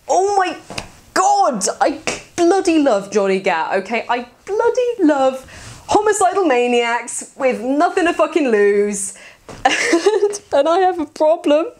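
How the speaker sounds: high, excited gushing; fangirl squeal. Real, not acted. Fan raving about Johnny Gat, high and excited. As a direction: squeal over your favourite character. Gushing over favourite character.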